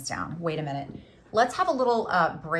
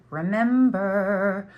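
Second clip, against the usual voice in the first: drawn out; 6 dB louder, much slower than usual. Real, not acted. Drawn-out reminder to viewers.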